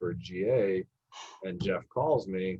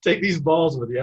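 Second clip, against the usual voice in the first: higher, louder generous coach; coach offering balls; 6 semitones higher, 6 dB louder than usual. Retold or imitated. Coach sending balls back, hearty and louder. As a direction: hand them over heartily. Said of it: "So he's like".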